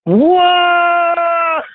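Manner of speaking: a high, loud shocked cry; surprised whoa. Real, not acted. Host hearing the cleaning lady's answer, loud shocked whoa. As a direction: yelp in disbelief. Reacting to explicit Russian answer.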